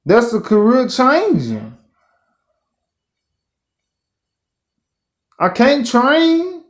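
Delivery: high, slow groan of dismay; high despairing complaint. Real, not acted. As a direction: disbelief at bad news. Dismayed at injury.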